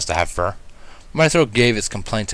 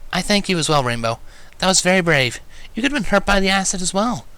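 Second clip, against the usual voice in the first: Twilight, grateful; 9 semitones higher than usual. A narrator's reading. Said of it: "Twilight chimed in".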